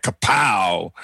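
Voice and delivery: shouted comic-book kapow. Retold or imitated. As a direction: bark out a mock explosion sound. Host mimics mic-drop impact.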